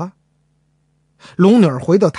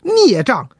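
short, sharp, higher cry of scorn; furious rebuke; 6 semitones higher than usual. Acted. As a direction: spit the insult at him. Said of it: "龙女儿脸都气黄了".

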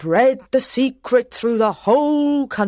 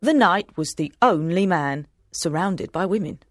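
ordinary delivery

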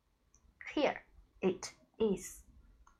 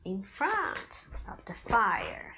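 put-on reading to a toddler; 3 semitones higher, 6 dB louder, much slower than usual. Mother reading to child.

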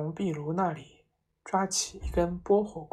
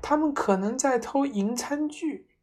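trembling; 8 semitones higher than usual. A narrator's reading. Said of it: "妈妈颤抖着说".

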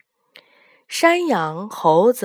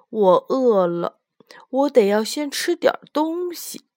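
lazy little pig voice, raised high and louder; lazy piglet; 4 semitones higher, 4 dB louder than usual. Acted. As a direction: drag it out like a sleepy, hungry piglet. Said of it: "它懒懒散散地说".

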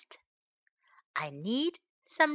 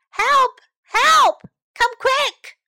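high, loud cry for help; loud cries for help; 12 semitones higher, 8 dB louder, slower than usual. Acted. Pigs shouting to Smokey for help. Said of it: "they heard loud voices".

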